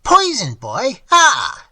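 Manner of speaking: high, piercing shriek; old man shrieking at his ward. Acted. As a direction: shriek in horror. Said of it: "shrieked the old man".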